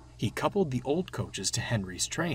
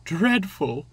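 higher, mildly mournful interjection; sad; 6 semitones higher, 5 dB louder than usual. A narrator's reading. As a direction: cut in with a touch of misery. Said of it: "interrupted Annie sadly".

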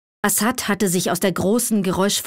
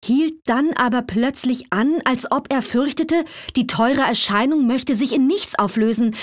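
ordinary delivery

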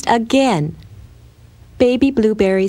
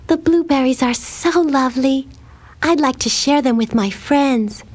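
lightly voiced sweet girl, higher pitch; dreamy shy little girl; 6 semitones higher than usual. A narrator's reading. Blueberry tells her pet mouse she'd like to share, lightly voiced girl.

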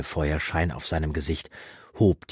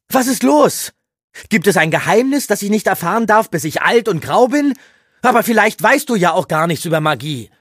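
much louder, much higher angry youth; defiant outburst; 12 dB louder than usual. Acted. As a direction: provoke the old man, shouting. Eragon fordert provozierend. (trotziger Ausbruch, laut und hoch)

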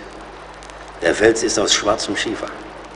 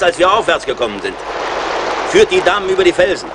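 raised voice, pitched higher, over the rushing falls; calling over roaring water; 7 semitones higher than usual. Acted. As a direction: call it out above the noise of the falls.

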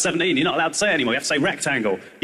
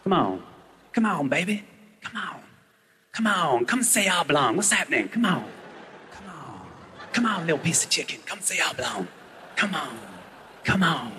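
creepy coaxing; 5 dB quieter than usual. Retold or imitated. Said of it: "I go".

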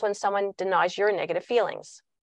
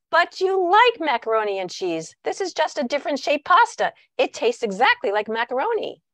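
high, insistent persuasion; coaxing parent; 5 semitones higher, 4 dB louder than usual. Acted. Parent coaxing a child to eat pasta, high-pitched insistence. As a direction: act a parent selling dinner to a doubtful child.

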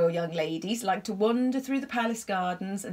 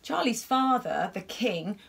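ordinary delivery, nothing marked

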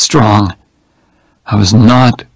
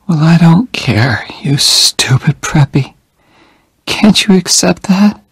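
light, higher teasing voice; teasing dying woman; 7 semitones higher than usual. A narrator's reading. Jenny teasing Oliver from her bed, higher and light. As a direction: tease him gently though weak.

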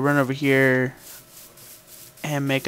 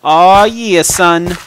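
bragging Oh yeah, son, much louder; loud boast; 4 semitones higher, 8 dB louder, much slower than usual. Shown as a demonstration. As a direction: crow it out like a winner. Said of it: "repeated louder as a boast".

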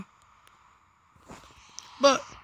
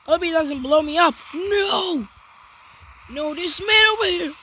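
raised, urgent voice under threat; panicked alarm; 4 semitones higher, 4 dB louder than usual. Real, not acted. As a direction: cry out as the danger closes in. Creeper threatens to blow player up.

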